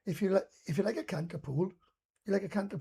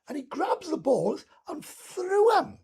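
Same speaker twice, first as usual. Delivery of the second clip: ordinary delivery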